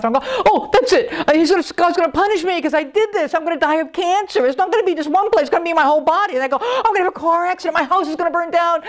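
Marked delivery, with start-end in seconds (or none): 0.5-6.3 high-pitched spiralling dread; frantic worrier, voice pitched way up; retold panic. Acts out his panicked thoughts. 6.6-8.8 high, hurried catastrophizing; frantic high-pitched mock dread; retold panic. Acts out his catastrophizing.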